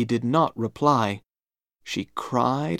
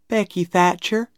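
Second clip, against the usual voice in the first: lightly voiced, higher-pitched schoolgirl; girl introducing herself; 7 semitones higher than usual. A narrator's reading.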